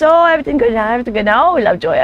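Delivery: normal talking voice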